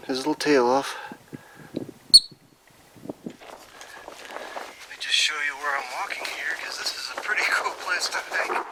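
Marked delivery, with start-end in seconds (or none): 3.2-8.7 soft whisper. Real, not acted. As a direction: whisper so not to scare the marmot. Quiet near a marmot.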